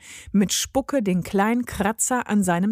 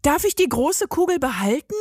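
put-on eager child; 6 semitones higher than usual. A narrator's reading. Daughter asks mother.

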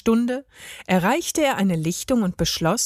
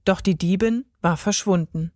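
ordinary delivery, nothing marked

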